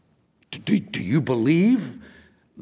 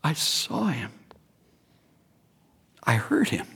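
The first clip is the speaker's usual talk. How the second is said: hushed awe; 4 semitones lower, 6 dB quieter than usual. Acted. Acting Paul awed by the vision.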